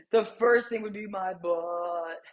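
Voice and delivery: lightly slowed slow-motion voice. Pretend. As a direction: drag the words a little like slowed-down film. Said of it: "I'm trying to do a slow motion voice".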